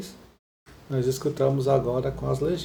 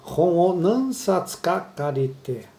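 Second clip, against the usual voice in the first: slow repeat; 2 semitones higher, slower than usual. Shown as a demonstration. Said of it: "Ela fala".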